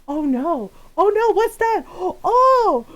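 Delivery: high, loud fake-scared teasing; mock alarm. Sister scaring her little brother, in loud mock alarm. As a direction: build into a hammed-up fright to scare him. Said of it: "she was so mean to him".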